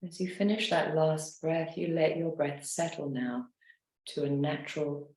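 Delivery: guided meditation. Acted. Leads a guided relaxation.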